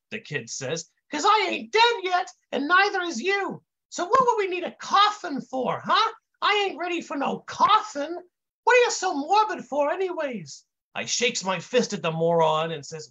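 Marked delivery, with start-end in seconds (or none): 1.1-10.6 high, dim-witted intern protest; high kid voice. A narrator's reading. Confused kid denies coughing on anybody, high voice. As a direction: keep it way up, dopey and indignant. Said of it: "the kid says in this high voice".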